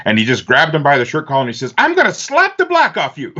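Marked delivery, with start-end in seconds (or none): 1.8-3.3 very high, comic outburst; imitated exasperated preacher's threat; mock threat. Retold pastor's threat, joking.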